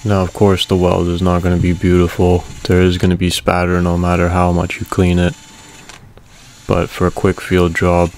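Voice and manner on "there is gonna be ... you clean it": ordinary delivery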